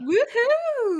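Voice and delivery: loud, high celebratory whoop; excited cheer. Real, not acted. Friend cheering the top fundraiser, excited whoop.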